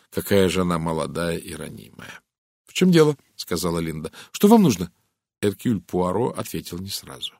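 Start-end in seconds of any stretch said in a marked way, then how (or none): 2.7-3.2 high, loud, sharp question; defensive challenge. A narrator's reading. Hunted Linda confronts the approaching Poirot, sharp and high. Wary girl challenges the detective. 4.3-4.9 high, loud, sharp question; defensive challenge. A narrator's reading. Hunted Linda confronts the approaching Poirot, sharp and high. Wary girl challenges the detective.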